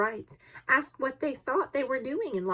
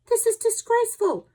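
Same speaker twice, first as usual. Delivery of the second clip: loud, high cry of indignation; outraged outburst; 14 semitones higher, 8 dB louder than usual. A narrator's reading. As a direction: burst out in fury, pitch way up. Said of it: "cried Ned Land, bursting out".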